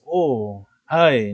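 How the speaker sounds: "ay" que salta más fuerte y agudo; interjección de sorpresa. Shown as a demonstration. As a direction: exclama como sorprendido de golpe. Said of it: "Cuando dices". (interjección sorprendida, "ay" más alto y fuerte)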